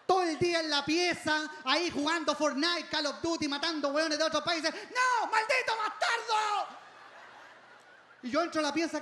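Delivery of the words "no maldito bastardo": high furious shout at the screen; gamer rage yell. Retold or imitated. Son yelling at rival players in his game, furious shout. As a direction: rage at an online enemy.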